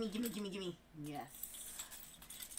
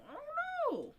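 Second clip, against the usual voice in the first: high squeal; slower than usual. Real, not acted. Repeats it higher in a squeal.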